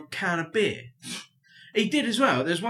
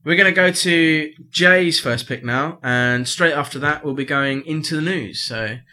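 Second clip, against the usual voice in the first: radio link; 8 dB louder than usual. Real, not acted. Radio host introducing the next pick to listeners, loud DJ voice. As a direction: announce the next track like a DJ. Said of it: "radio host link to next song".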